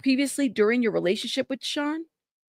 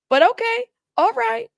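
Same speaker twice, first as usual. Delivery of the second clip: high sarcastic okay, much higher; pitched way up in disbelief; 11 semitones higher, 4 dB louder than usual. Real, not acted. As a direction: drip with mock approval. Sarcastic reaction.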